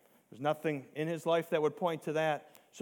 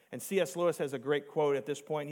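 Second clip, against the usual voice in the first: normal talking voice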